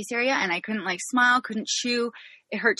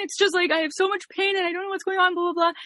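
mock bawling; 10 semitones higher than usual. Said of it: "I'm just, like, bawling uncontrollably".

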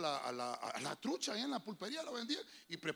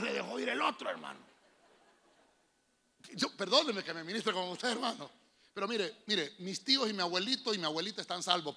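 ordinary talk

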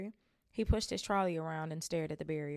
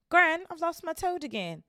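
much higher, louder whine; whiny boy; 12 semitones higher, 9 dB louder than usual. Acted. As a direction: wail to gran. Neville whining to gran.

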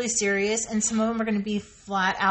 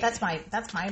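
understated first claim; 2 semitones lower, 6 dB quieter than usual. Acted. Comic escalating repeated claim.

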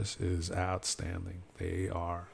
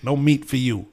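lightly voiced waitress cutting him off; a hint of a strict server; imitating the server; 4 semitones higher, 5 dB louder than usual. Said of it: "She goes".

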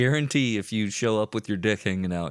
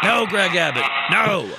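higher, louder anguished no; mock outcry; 9 semitones higher, 8 dB louder than usual. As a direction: cry out to stop him. Mock protest at Greg Abbott.

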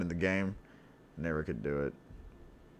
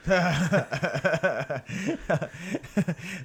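big laugh; 9 semitones higher than usual. Real, not acted. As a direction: crack up at the joke. Laughing at joke.

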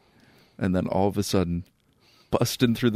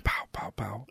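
whispered "pow pow pow"; imitated gunshots; 10 dB quieter than usual. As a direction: mouth soft little gunshot noises under your breath. Quietly imitating the gun shooting kids.

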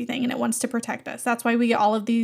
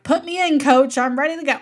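eager sporty plea; 3 semitones higher, 5 dB louder than usual. Retold or imitated. Eager request to boss.

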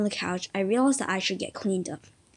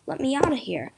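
higher-pitched, understated yell; a touch of a shout to be let out; mild yell; 6 semitones higher than usual. A narrator's reading. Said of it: "Dominus yelled".

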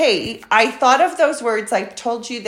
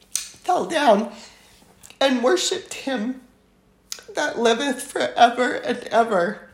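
emotional; 3 semitones higher than usual. Real, not acted. Reader moved by elders worshipping him, emotional. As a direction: push through the verse as emotion takes over. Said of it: "it makes me emotional".

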